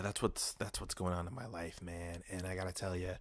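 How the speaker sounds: ordinary talk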